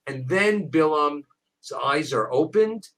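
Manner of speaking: usual speaking voice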